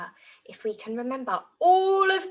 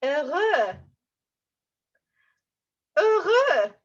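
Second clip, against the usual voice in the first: bright, happy and louder; 5 semitones higher, 6 dB louder, slower than usual. Shown as a demonstration. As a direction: say it like you're really happy. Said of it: "How do you think I feel?".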